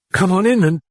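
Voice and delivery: a touch of a quaver. Acted. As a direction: let the fear shake the words. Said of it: "Chris quavered".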